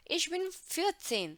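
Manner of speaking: lifted teacher reading voice; slow bright reading. Shown as a demonstration. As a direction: read it out slowly for the class. Teacher reading line slowly, high.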